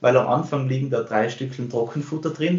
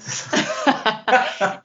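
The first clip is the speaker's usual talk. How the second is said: short high laugh at herself; embarrassed laugh; 12 semitones higher than usual. Real, not acted. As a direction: laugh it off, a bit sheepish. Laughs after losing thread.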